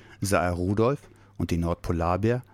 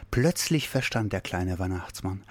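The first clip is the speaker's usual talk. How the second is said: plain reading voice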